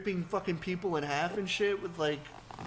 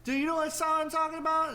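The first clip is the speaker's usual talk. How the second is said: eager, much higher voice; excited high question; 10 semitones higher, 3 dB louder than usual. Retold or imitated. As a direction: pester him excitedly. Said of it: "he's just like".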